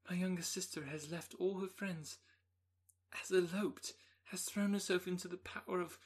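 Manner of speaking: high, trembling, on the edge of tears; distressed young woman. Acted. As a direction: pour out the terrible news, near breaking. Elizabeth tells Darcy dreadful news.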